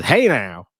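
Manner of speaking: put-on light Alabama accent on a greeting; bad Alabama accent. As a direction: attempt a hammy Alabama accent. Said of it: "the worst Alabama accent".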